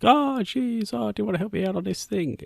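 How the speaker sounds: very high put-on voice, sliding down; imitated favour-asker. Old contact asking for help, mock pleading. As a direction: mimic a pleading acquaintance in a high pitch. Voices person who sent message.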